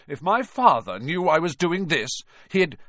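put-on spoiled boy complaining; whiny protest. Malfoy whining protest.

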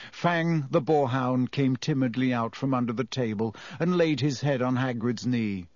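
ordinary delivery, nothing marked

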